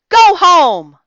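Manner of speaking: loud, high explosive bah; angry scoff. A narrator's reading. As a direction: dismiss them in a temper. Grumpy Santa refuses visitors.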